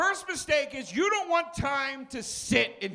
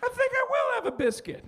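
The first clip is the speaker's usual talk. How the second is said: much higher, airy daydream voice; mock dreamy resolve; 13 semitones higher, faster than usual. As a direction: float the idea like a blissful fool. Decision in food coma.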